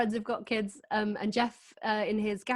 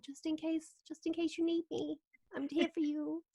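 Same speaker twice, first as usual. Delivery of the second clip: higher, softer coaxing bottle voice; tempting wine bottle; 5 semitones higher, 9 dB quieter than usual. Pretend. The wine in the fridge tempting her, soft and higher. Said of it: "there's a bottle in there going like".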